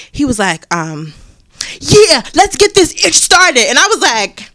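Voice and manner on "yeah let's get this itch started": put-on high, loud, drawn-out kid shout; stretched, loud schoolboy hype; hyped kid. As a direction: ham up a rowdy fifth grader. Kid quoted, excited.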